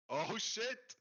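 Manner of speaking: high-pitched burst of surprise; sudden high yelp of amazement; startled exclamation. Real, not acted. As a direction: blurt it out high, in real surprise. Sudden reaction to the market.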